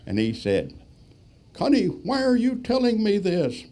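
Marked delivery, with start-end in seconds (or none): 1.5-3.6 high, loud, frantic squeak of a question; a scared passenger begging to know why; imitating a panicking back-seat student pilot; panicky plea. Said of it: "just panicking".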